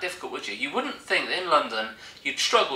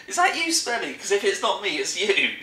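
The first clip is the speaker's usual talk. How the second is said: high, indignant quote; high-pitched retort; 6 semitones higher than usual. Retold or imitated. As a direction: act out an accusation, pitched up. Retold accusing remark, acted out.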